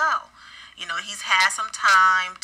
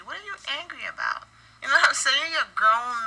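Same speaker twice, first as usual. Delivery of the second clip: pitched way up, quick and incredulous; what are you angry about, higher and faster; exasperated; 6 semitones higher, faster than usual. Real, not acted. Commentator asks Michael why he is so angry, exasperated. Indignant rhetorical question to Michael.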